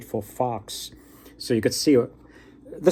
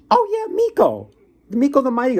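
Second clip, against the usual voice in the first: very high, louder, overeager bluff; nervous fake-knowing king; 13 semitones higher, 5 dB louder than usual. Nervous king pretending to know Miko, squeaky high bluff. King voiced in folk tale.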